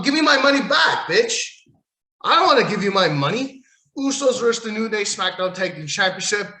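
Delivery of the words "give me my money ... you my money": angry rant. Real, not acted. Ranting about a dull match.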